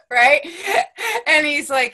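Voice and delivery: high and loud, still laughing; laughing through words. Real, not acted. Still laughing as she tells her friend, words through giggles.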